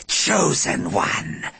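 slow hissed alien voice; hissing alien. Acted. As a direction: hiss it slowly like a predator. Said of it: "hissed the Scree-wee".

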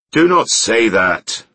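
loud, slow, high outburst; stormy. A narrator's reading. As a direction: storm at him forcefully. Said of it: "stormed Claw Givus".

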